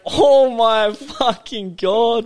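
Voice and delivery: slow, high, loud shocked exclamation; a worker floored by the dad's offer; stunned disbelief. Retold or imitated. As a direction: drag it out in total disbelief. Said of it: "I was just like".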